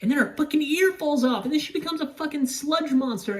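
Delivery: high-pitched, incredulous rush of events; worked-up retelling. Real, not acted. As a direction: pile up the horrors in disbelief. Worked-up retelling of horror climax.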